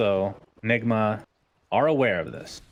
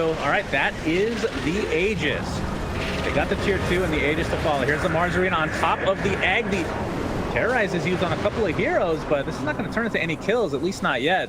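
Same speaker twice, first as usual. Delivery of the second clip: higher-pitched esports fight call; live play-by-play; 7 semitones higher than usual. Real, not acted. Caster telling viewers who survives the fight, higher-pitched play-by-play. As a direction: call the team fight as it unfolds.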